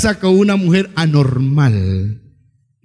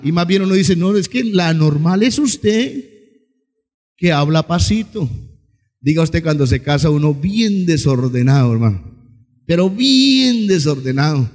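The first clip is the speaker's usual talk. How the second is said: normal talking voice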